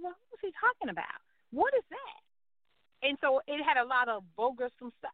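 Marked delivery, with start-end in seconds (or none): 0.0-3.1 higher, slow bewildered wondering; mock disbelief at the script; baffled thinking. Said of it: "I'm thinking".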